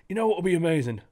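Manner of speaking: hammed-up Cockney accent; Cockney exec. Valve exec dreaming up profits, put-on Cockney accent. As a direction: lay on a cheeky Cockney accent as the scheming exec. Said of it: "I don't know why I'm Cockney".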